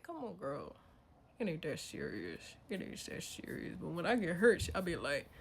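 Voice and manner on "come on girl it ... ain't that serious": very soft, low talk-down; quiet, dropped-voice reassurance to oneself; hushed calming. Acted. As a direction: murmur it to settle a wild friend. Talking the inner voice down.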